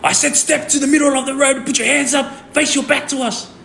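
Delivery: high-pitched mock shouting; imitated police yell. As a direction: re-enact an officer yelling orders. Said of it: "he yelled at me".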